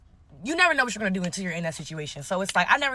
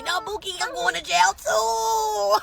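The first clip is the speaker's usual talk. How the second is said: mock wail; 10 semitones higher, slower than usual. Woman to friend telling police everything, mock wail. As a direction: wail it in hammed-up despair.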